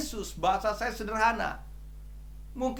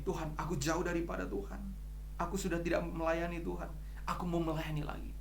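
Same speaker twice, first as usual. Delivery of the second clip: humble prayer; 8 dB quieter than usual. Retold or imitated. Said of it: "orang-orang yang begini".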